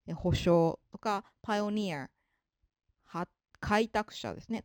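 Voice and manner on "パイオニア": パイオニア with the ending ニア stressed; stress on final syllable. Shown as a demonstration. Teacher giving an accent example, パイオニア stressed on final ニア. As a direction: put the weight on ニア in パイオニア. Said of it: "そこを強く読むんですね".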